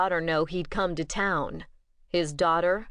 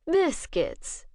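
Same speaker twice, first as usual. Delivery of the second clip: Biscuits said higher, with a quirk of a smile; amused agreement; 9 semitones higher than usual. Acted. Bishop humors the vampire woman, wry amused agreement. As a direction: indulge her with a wry little smile in the voice. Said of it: "Bishop agreed with a quirk of a smile".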